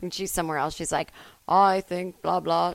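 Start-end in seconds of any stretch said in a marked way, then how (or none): 1.5-2.7 put-on JFK accent. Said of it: "She sounds like J F K".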